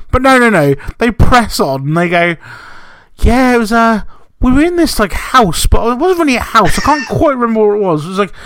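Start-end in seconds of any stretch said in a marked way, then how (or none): 3.2-8.3 higher, rambling dream recap, lightly mocked; imitating Jay. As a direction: ham up a partner who won't stop about a dream. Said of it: "impression of Jay talking to you".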